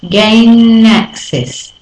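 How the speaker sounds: "Gain access." said very slowly; slow demo. Shown as a demonstration. Teacher slowing a phrase to show linking. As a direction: stretch the n into access. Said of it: "notice that as I go".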